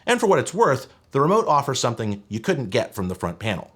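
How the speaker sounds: normal talking voice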